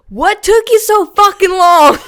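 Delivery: very high, loud mock-scolding yell with laughter; laughing shout. Coach yelling at the racer arriving late, laughing. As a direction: scold her as a joke. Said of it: "and I go".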